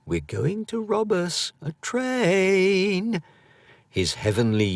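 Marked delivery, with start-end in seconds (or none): 0.1-3.2 put-on high, slow child voice; a small boy's bold outlaw boast; boy playing outlaw. A narrator's reading. Boy playing Billy the Kid in the woods.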